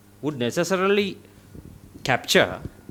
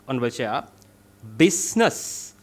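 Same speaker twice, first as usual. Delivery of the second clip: ordinary talk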